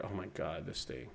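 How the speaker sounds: soft annoyed mutter while fighting a login; frustrated muttering. Real, not acted. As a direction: grumble quietly to yourself at the screen. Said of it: "so frustrating".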